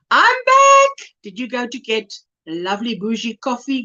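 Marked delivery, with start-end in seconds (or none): 0.1-1.1 high, bright announcement; excited. Retold or imitated. Voicing a viewer coming back to the chat, excitedly high. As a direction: chirp it out brightly. Reads viewer's return cheerfully.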